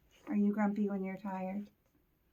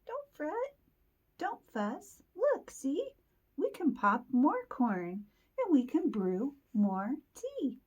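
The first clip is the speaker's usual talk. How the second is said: much higher little mouse voice; squeaky mouse voice; 6 semitones higher than usual. Acted. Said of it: "Mouse squeaks".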